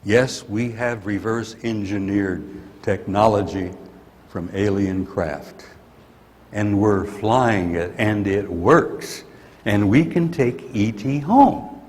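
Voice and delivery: slow, deliberate spelling out of the secret; slow and pointed. Retold or imitated. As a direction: lay it out slowly, word by word, as if daring them. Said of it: "saying literally in their face".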